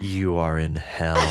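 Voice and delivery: playful sinister hypnotist; mock hypnotic doom. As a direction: intone a horrible dream in jest. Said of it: "really horrible. It's like".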